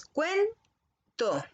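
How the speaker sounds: cuento drawn out very slowly as cuen-to; teacher dividing cuento into syllables; split into syllables. Shown as a demonstration. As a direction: stretch cuento into two slow syllables, cuen-to. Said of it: "Si separan esa palabra en sílabas".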